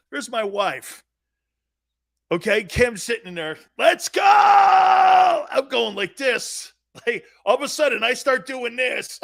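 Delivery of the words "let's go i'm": high, loud, drawn-out yelp; panicked shout. Retold or imitated. New dad panicking at the birth, shouting. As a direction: freak out loudly.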